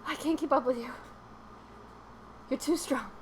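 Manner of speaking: mock breathless; puffing after a run; out of breath. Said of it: "I'm out of breath".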